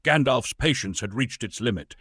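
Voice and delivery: ordinary delivery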